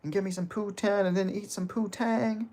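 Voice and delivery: high, mocking trash-talk voice, up about seven semitones; hammed-up Colby taunt. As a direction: sneer it out like a cocky heel. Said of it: "Colby line was about G S P".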